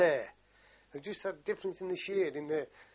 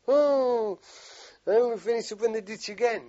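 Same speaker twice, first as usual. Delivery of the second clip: higher and louder, laughing it out; laughing exclamation; 7 semitones higher, 8 dB louder than usual. Real, not acted. Telling the interviewer about the ditch, laughing loudly. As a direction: burst out amused as the truck lands in the ditch again.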